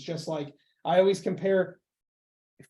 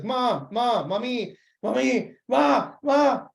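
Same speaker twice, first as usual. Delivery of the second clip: high, insistent pestering calls; repeated calls, building louder and higher; Stewie; 5 semitones higher, 4 dB louder, much slower than usual. Retold or imitated. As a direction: imitate a cartoon child nagging for mother. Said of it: "Stewie goes to Lois and like".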